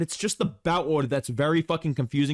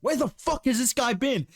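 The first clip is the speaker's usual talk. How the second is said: raised, heated rant with a laugh breaking through; pissed off; 7 semitones higher than usual. Real, not acted. Ranting to his co-host about Kron Gracie, fed up and heated. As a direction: vent the frustration, pitched up and pushing. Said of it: "I'm pissed off".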